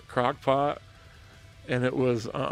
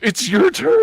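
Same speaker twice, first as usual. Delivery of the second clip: high, loud, laughing it's your turn; louder, higher laughing jab at his wife; laughing tease; 12 dB louder than usual. Retold or imitated. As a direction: gloat through laughter. Teasing wife at church meal.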